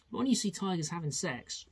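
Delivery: normal talking voice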